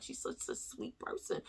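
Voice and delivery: whispered sweet voice; soft quote. Retold or imitated. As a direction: breathe it out like gossip. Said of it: "they be like".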